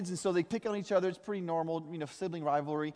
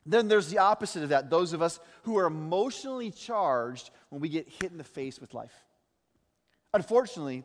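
ordinary talk, nothing marked

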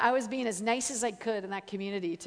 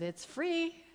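coaxing offer; 7 semitones higher, 5 dB quieter than usual. Retold or imitated. Friend's offer quoted to drug dealer.

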